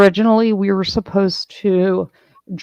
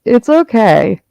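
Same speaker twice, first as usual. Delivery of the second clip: louder, teasing reassurance; mock reassuring tease; 6 dB louder than usual. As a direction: reassure them with a sly grin. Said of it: "I was like".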